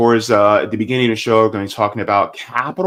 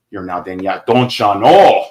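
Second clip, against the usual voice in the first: put-on Minnesota accent; 2 semitones lower, 5 dB louder than usual. Greeting a viewer from Minnesota in a Minnesota accent. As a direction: play up a Minnesota accent, don't ya know. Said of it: "Ooh, Minnesota".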